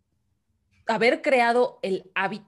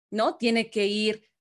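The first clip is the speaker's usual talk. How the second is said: ordinary talk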